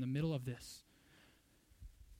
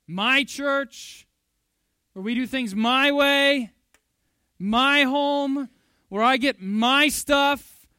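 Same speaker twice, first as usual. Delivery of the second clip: loud, higher possessive shouting of mine; selfish mine; 7 semitones higher, 14 dB louder, much slower than usual. Retold or imitated. Pastor mocking a grasping churchgoer, loud possessive shouting. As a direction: belt out a greedy child's demands.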